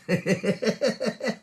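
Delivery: high, rippling giggle; laugh. Real, not acted. Host amused after saying thanks to viewers, laughing. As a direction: giggle in a quick run.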